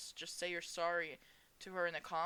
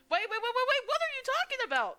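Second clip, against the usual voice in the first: much higher and louder, urgent stop; alarmed cry; 11 dB louder than usual. Acted. Sam hearing Lorelei will leave, shocked and shouting. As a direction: stop her before she goes.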